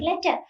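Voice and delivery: "letter" with no R at the end; silent final R. Shown as a demonstration. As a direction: end "letter" on a plain vowel, R dropped. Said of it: "R is silent".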